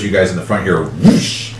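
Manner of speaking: ordinary talk, nothing marked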